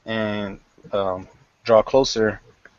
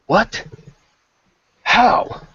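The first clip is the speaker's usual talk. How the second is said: much higher, sharp burst of disbelief; shocked outcry; 13 semitones higher, 4 dB louder than usual. Acted. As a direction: gasp it out in disbelief. Mistress hears brother died.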